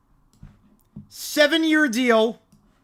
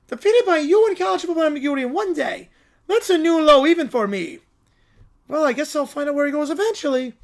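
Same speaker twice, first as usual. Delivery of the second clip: high, indignant villain lament; defeated cartoon villain; 5 semitones higher, 4 dB louder than usual. Villain beaten by Perry, high aggrieved grumbling. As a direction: bemoan it with cartoon exasperation.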